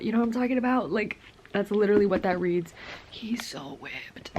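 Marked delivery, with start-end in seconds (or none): 3.1-4.3 slow teasing whisper under the breath; whispered tease. Real, not acted. Gushing to viewers about the hero, whispered slowly. Whispered comment on love interest.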